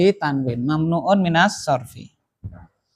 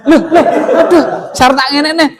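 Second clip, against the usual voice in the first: laughing dismay; 8 semitones higher, 6 dB louder, faster than usual. Real, not acted. Teacher laughs at a mistake.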